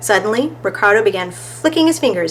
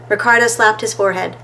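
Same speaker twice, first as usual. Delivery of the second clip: ordinary delivery, nothing marked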